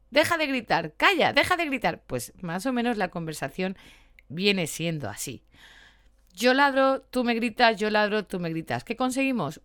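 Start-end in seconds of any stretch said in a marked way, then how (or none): 0.1-2.0 higher and louder, hammed-up shout of the cartoon dog; a dog yelling stop yelling; mock yell back. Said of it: "y el perro al humano". 6.3-7.8 emphatic mock shout. Acting out dog and owner.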